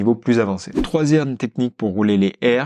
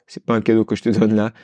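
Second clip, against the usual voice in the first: ordinary talk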